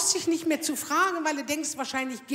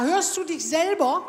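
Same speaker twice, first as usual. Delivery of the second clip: slow, louder disbelief with a laugh; indignant retort; 3 semitones higher, 5 dB louder, slower than usual. Retold or imitated. Ingrid to her doctor, slow incredulous retort. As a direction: spell it out like he's daft.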